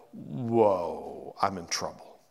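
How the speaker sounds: low, moaning whoa of dread; a touch of a groaning lament. Retold or imitated. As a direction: moan it like a man who's done for. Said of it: "a cross between a moan".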